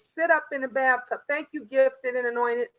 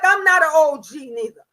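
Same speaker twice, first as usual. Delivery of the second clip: louder, offended comeback; indignant retort; 8 dB louder than usual. Retold or imitated. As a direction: object loudly and proudly. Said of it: "I'm like".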